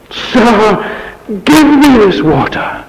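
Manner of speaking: high, loud entreaty; earnest plea. Acted. Samaritan woman asks Jesus for living water, pleading. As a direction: cry out the request, pitched well up and falling.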